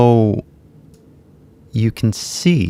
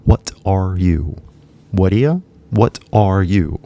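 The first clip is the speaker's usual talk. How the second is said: "What are ya" stretched out very slowly; slowed-down demo; much slower than usual. Shown as a demonstration. Teacher slowly breaks down "What are ya" for learners. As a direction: drag out "What are ya" then "What are you". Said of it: "But if you really listen".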